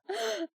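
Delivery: quick high intake of breath in delight; sharp starstruck gasp. Retold or imitated. Fan hearing Chris Sarandon's compliment, sharp delighted gasp. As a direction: gasp like your hero just hugged you. Fan overwhelmed by her idol.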